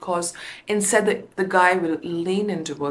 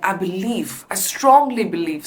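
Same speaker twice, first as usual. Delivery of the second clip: "strongly" pushed louder and falling; stress on STRONGLY; 4 dB louder than usual. Shown as a demonstration. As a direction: punch the word "strongly". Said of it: "I could emphasize on words I have to".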